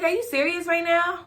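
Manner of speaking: high, loud incredulous question; amazed disbelief. Retold or imitated. Daughter to overjoyed mom, loud disbelief.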